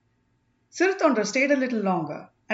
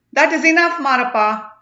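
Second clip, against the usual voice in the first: loud, high, sharp command to stop; stern rebuke; 5 semitones higher, 9 dB louder than usual. Acted. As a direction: cut him off with authority. Sage cuts off the witness.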